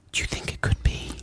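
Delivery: hushed, voiceless whisper; secretive stage whisper. Pretend. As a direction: whisper it as if not to be overheard. Hushed exchange between the hosts.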